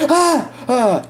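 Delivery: two high pained gasps; cries of pain. Retold or imitated. Patient breathing in with belly pain, cries of pain. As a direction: yelp sharply at each stab of pain. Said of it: "I would literally go like".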